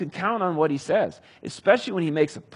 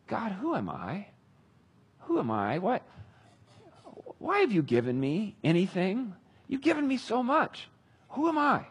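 awed humble prayer; 5 semitones higher than usual. Retold or imitated. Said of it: "he prays one of the great prayers".

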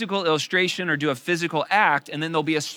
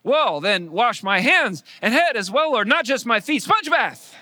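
higher, louder, slow pleading; overeager Peter; 8 semitones higher, 8 dB louder than usual. Retold or imitated. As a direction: gush with comic overenthusiasm. Peter eagerly overdoes request.

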